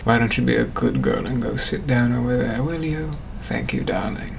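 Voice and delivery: low, soft posh British purr; low suave British gent; British gentleman. Acted. British gentleman sweet-talking his darling, low. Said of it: "a British gentleman from the eighteen hundreds".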